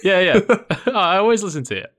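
laughing. Real, not acted. Teasing, laughing reply.

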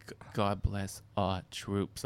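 slow, solemn mom voice; mock a solemn blessing; imitating mom. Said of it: "My mom was just like".

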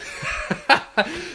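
high, airless giggle; can't hold back a giggle; breathy laugh. Real, not acted. Laughing to listeners about ruining a relationship, a high breathy giggle.

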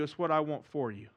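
usual speaking voice

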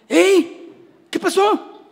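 high sudden cry of surprise at an empty wallet; shocked exclamation; startled surprise. Acted. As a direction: yelp it like you just found the wallet empty. Finding empty wallet, acted.

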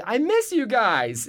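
high, drawn-out fake affection; mock gushing. Castmate texting after the show, mock gushing. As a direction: gush it slowly with put-on fondness. Mocking insincere on-camera friends.

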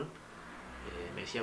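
ordinary talk

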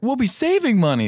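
high, eager wife voice; wife suddenly thrilled by the savings; excited wife. Retold or imitated. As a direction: sell it with delight. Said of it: "She's like".

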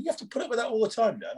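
high, quick disbelieving question; incredulous onlooker. Retold or imitated. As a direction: mimic someone amazed, voice pitched right up. Acted quote of astonished onlooker.

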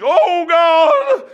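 high, drawn-out cry to God; loud prayer cry. Retold or imitated. Prayer warrior praying aloud in church, loud imitated cry. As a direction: imitate a fervent, wailing shout in prayer.

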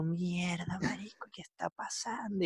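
hushed whisper to herself. Retold or imitated. Whispering in shock beside her crying niece. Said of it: "y yo".

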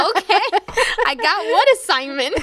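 high, puzzled retort; baffled by a student's joke; confused surprise. Retold or imitated. Teacher to student, a high puzzled question.

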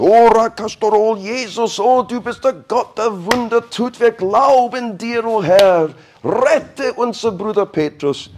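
high, loud, gushing prayer; fervent praying Christians. Pretend. Church members begging God to save Peter, high fervent prayer.

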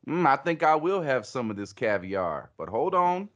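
put-on Southern diner, pitch climbing at the end; hammed-up Southern gent pausing proceedings; Tex Pablo. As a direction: play a twangy Southerner savoring the offer. Acting out Tex Pablo at dinner.

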